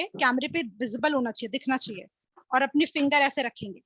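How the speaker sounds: usual speaking voice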